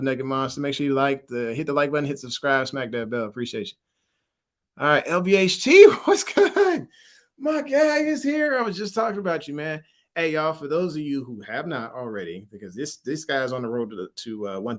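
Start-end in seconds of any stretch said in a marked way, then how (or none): 4.8-9.8 high, loud, laughing welcome; excited greeting. Real, not acted. Host welcomes his guy into the live stream, excited. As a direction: hail your buddy with a big high-pitched whoop. Greets a friend arriving in chat.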